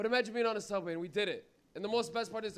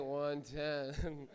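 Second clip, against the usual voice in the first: low, stoned-sounding answer; mock stoned guy, pitched down; really high; 6 semitones lower, 4 dB quieter than usual. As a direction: mimic a stoner giving an answer. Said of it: "the way he said it was really high".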